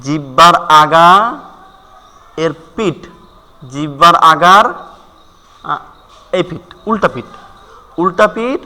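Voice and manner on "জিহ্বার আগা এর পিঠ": ordinary talk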